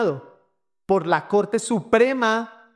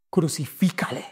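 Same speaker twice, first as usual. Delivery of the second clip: without mercy; 4 semitones lower than usual. Retold or imitated. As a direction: say it coldly, without pity. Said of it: "sin piedad".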